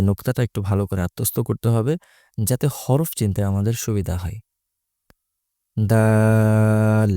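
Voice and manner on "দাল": দাল with a very long level vowel; long-drawn দাল. Shown as a demonstration. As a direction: hold the middle of দাল on one note. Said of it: "তিন আলিফ পরিমাণ টান". (দাল লম্বা টেনে)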